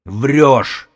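high, loud roar; furious outburst at full volume. A narrator's reading. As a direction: roar the accusation. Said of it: "заревел Додо".

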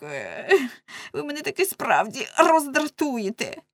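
plaintive whine with swooping pitch; pestered hapless man. Acted. Man begging women to stop tugging him, whining protest. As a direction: complain pitifully, stretching the cries.

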